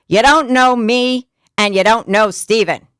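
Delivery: jab the words out in thick Jersey; Jersey accent. Retold or imitated. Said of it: "In your best, in your best Jersey accent".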